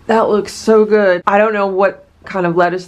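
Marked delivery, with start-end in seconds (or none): none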